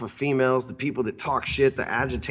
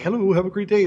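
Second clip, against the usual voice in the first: higher, bright, canned cheer; mock stewardess; 8 semitones higher, 4 dB louder than usual. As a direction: parody a sing-song flight attendant greeting. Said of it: "Stewardess, and they're like".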